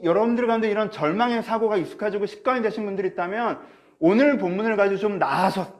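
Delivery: ordinary talk, nothing marked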